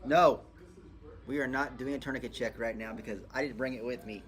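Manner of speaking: ordinary talk, nothing marked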